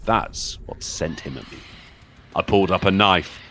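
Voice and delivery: low, hushed horror narration; creepy story narration. Acted. Streamer reading a creepypasta to chat, hushed and ominous. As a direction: spin a scary tale for the viewers.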